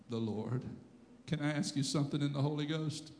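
ordinary talk, nothing marked

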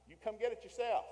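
high, loud comeback from a wife; sharp retort. Acted. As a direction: throw the request straight back, loudly. Wife's retort to husband's request.

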